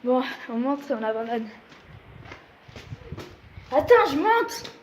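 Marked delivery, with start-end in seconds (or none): 3.7-4.7 calling out. Real, not acted. Calling up to friend.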